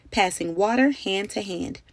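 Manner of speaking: ordinary delivery, nothing marked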